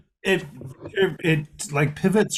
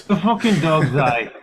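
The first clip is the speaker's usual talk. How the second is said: mock Aussie slang; 3 dB louder than usual. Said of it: "the Australian accent".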